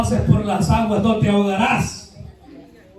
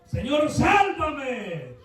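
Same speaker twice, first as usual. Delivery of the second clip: much higher shouted plea, the last words slow and fading; Peter's cry for help; 7 semitones higher, much slower than usual. Acted. Sinking Peter shouting to the Lord, desperate plea. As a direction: cry out to be saved from the water. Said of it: "él le gritó y le dijo".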